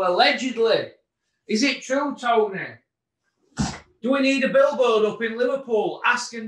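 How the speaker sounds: goading mock indignation; mocking challenge. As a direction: needle him in a high, indignant voice. Mock challenge addressed to absent boxer.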